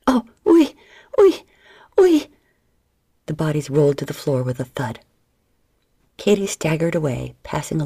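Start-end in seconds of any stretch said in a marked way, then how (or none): 0.1-2.3 much higher, louder climbing cries of oui; ecstatic cries. Acted. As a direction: build each oui higher in pleasure. Climax cries in French.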